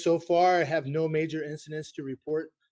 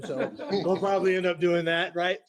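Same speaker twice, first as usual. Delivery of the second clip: laughing; 4 semitones higher, 9 dB louder than usual. Real, not acted. Joking about running a 5K.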